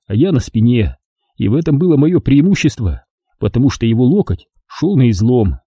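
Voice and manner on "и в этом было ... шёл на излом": plain reading voice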